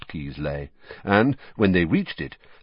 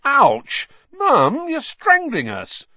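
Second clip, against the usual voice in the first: put-on squeezed teenage boy; 5 dB louder, much slower than usual. Twins squeezed in a hug.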